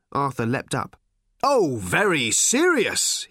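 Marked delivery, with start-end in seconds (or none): 1.4-3.3 slow, louder mocking shout; a sarcastic shout, drawn out. A narrator's reading. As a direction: bite off each word with scorn. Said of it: "he shouted".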